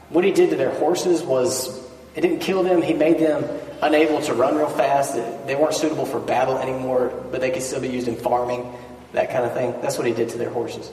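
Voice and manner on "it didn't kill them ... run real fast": usual speaking voice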